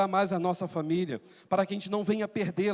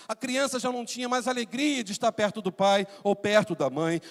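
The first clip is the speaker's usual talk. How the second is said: ordinary talk, nothing marked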